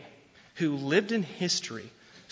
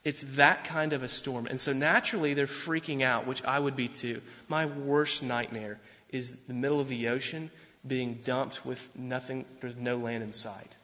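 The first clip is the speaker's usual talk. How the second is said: ordinary talk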